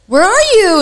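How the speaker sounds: put-on pressing a scared kid for an answer; urgent worry. Anxious question on the call.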